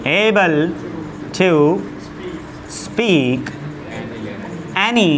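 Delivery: each word dictated alone with long pauses; slow dictation. Shown as a demonstration. Teacher dictating a sentence to students, word by word. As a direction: spell it out slowly for the class to write down.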